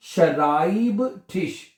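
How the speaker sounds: शराइब ठिश said slowly in parts; broken up slowly. Shown as a demonstration. Teacher shows students how to break the word, slow. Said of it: "लफ्ज को तोड़ लिया करें".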